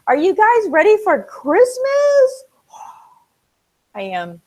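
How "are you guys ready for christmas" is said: high, loud, drawn-out cheer; excited hype. Real, not acted. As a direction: hype up the crowd with a long rising call. Hyping up viewers for Christmas.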